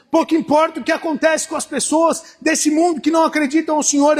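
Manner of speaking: rising sermon fervor; preaching. Acted. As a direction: lift the crowd with each "no dia". Preaching against bad news.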